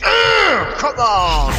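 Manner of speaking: high mock shout, strained; hammed-up action hero yell; Rambo battle cry. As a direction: roar it like a movie hero spoiling for a fight. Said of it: "Rambo's going".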